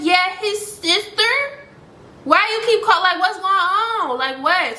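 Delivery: retold snappish comeback, pitched high. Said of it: "Don't raise your voice at me".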